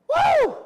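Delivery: high whooping cheer; a loud high celebratory whoop; loud high woo. Real, not acted. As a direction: let out a big high woo. Whoops after laughing at himself.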